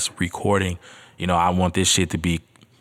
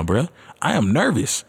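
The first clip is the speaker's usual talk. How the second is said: ordinary delivery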